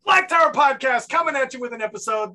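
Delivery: loud, high show-opening hype; podcast announcer. Acted. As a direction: belt out the intro like an announcer.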